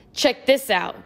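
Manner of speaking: high, swaggering show-off; boastful, cocky call; bravado. A narrator's reading. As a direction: show off the knife to impress friends. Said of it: "he said with bravado".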